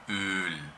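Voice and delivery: Öl with a long Ö. Shown as a demonstration. Teacher showing the long vowel in Öl. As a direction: hold the vowel in Öl. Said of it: "Esto es una vocal larga".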